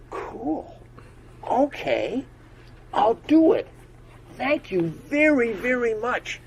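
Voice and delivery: put-on high, loud childlike pleading; young unicorn explaining to the frog why he's sad; little unicorn voice. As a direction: pour it out like an upset small child.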